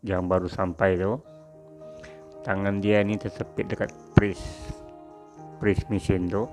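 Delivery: plain reading voice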